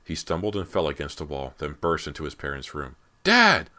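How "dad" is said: very high, very loud panicked shout; desperate, piercing cry of alarm; frantic scream. A narrator's reading. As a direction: scream at the top of the voice. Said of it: "Brant screamed frantically as he flew".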